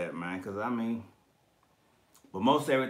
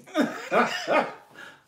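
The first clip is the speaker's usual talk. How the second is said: real laugh, pitched way up; high wheezing laugh; 7 dB louder than usual. Real, not acted. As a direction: lose it again, high and breathless. Cracking up again.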